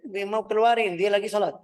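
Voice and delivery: high, loud retold yelling; mock shout. People yelling about the imam during the fire, loud. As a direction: call out in alarm about the fire. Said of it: "Mereka teriak".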